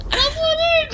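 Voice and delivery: put-on very high girly call; put-on girl voice. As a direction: call him in as the decoy girl. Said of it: "you do your voice".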